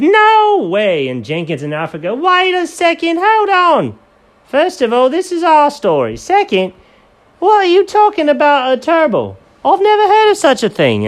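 very high, loud squeaky voice; high squeaky sidekicks protesting; squeaky characters. Acted. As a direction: go way up into a cartoon squeak. Jenkins and Alfred squeaky.

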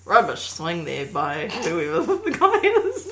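slow commentator put-on breaking into laughter; golf commentator. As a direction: commentate slowly, cracking up midway. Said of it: "Or the commentator you could hear".

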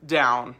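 DOWN low and final; voice dropping down. Shown as a demonstration. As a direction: drop DOWN as a firm instruction to a subordinate. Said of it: "your voice can drop down".

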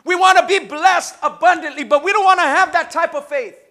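fiery sermon voice; preaching at full force. Acted. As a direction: drive it home to the church.

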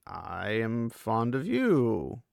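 very slow, drawn-out confession; acted slow, careful declaration; really slowly. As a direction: stretch every word out deliberately. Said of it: "really slowly".

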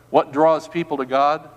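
ordinary delivery

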